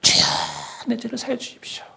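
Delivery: high, soft whispered plea; whispered mock prayer. Preacher mimics dawn prayer, whispered high plea. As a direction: breathe it out like a dawn penitent. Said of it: "새벽에 딱 기도해요".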